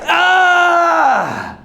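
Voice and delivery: long, high triumphant scream; acted ecstatic yell; victory scream. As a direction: scream out the win.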